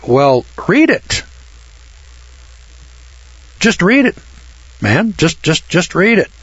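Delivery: impatient urging. Acted. Banker pushes John to read.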